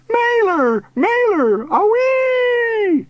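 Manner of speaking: very high, cartoonish flying shout. A narrator's reading. Uncle swooping overhead, high shouting. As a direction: call it out wildly from the air. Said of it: "Uncle Arvy shouted".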